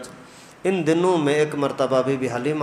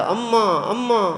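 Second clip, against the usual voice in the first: put-on a panting boy crying out to his mother; alarmed child's call; frightened calling; 7 semitones higher, slower than usual.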